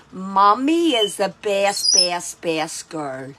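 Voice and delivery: low, slow sing-song coaxing; drawn out to teach the dog. Shown as a demonstration.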